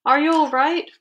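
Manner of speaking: urgent storybook call; worried yell. A narrator's reading. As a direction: shout down to check on someone. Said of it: "I yelled".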